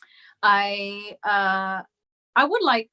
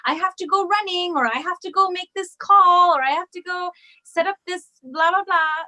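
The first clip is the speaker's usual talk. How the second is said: high, bright mock-busy friend voice, much higher and louder; imitating her friend; 9 semitones higher, 4 dB louder than usual. Teasing impression of a busy friend cutting the call short. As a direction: playfully mimic a friend rushing off the phone. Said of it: "you're always like".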